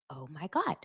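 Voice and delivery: soft disbelieving "oh my God"; stunned hush. Retold or imitated. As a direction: breathe it in quiet shock. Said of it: "I said".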